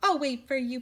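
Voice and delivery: plain reading voice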